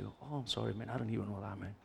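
very soft embarrassed sorry; sheepish apology. Retold or imitated. Preacher voices the usher apologizing for seating him. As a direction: back down quietly, embarrassed.

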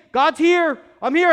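high, loud proclamation; exultant shout; triumphant announcement. Acted. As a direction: shout it out to the whole crowd. Jesus announcing his presence.

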